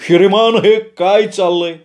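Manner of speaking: hint of an exasperated manager; manager's question. A narrator's reading. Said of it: "भानुदासला म्हणाले".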